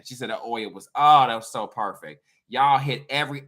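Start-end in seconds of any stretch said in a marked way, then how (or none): none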